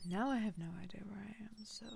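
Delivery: hushed, fast whisper to himself. Real, not acted. Lost on the map, whispering to the viewers.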